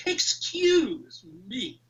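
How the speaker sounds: slow, drawn-out, haughty "Excuse me"; entitled posh voice. Retold or imitated. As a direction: demand attention like a posh Harrods shopper. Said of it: "And that was the voice".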